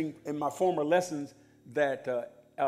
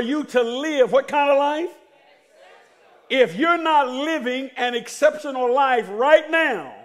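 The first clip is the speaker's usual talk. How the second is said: preaching; 8 semitones higher, 9 dB louder, slower than usual. Acted. Call and response preaching.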